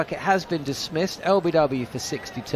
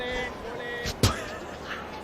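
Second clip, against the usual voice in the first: high unvoiced burst of laughter; 7 dB quieter than usual. Real, not acted. Commentator cracking up at a co-host's dress joke, bursting out laughing. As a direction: caught off guard, laugh high.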